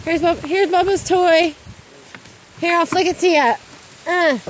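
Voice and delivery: high, loud sing-song pet voice. Real, not acted. Owner talking to her dog in the storm: high cooing baby talk.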